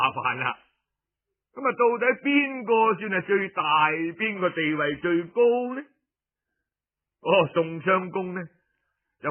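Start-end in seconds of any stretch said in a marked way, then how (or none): none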